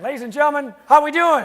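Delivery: big, high, booming call to the room; rallying the crowd. Real, not acted. Speaker retries the greeting with the group, loud rallying shout. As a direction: shout it to fire up the audience.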